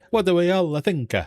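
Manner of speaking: high, hammy mock Italian; Italian accent. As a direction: ask it in a put-on Italian accent. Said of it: "In my Italian accent".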